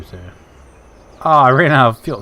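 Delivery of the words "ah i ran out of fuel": loud annoyed groan of a line; frustrated shout. Real, not acted. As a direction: blurt it out, annoyed. Ran out of fuel in game.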